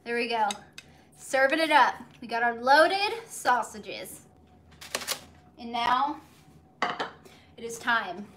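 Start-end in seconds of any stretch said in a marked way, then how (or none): none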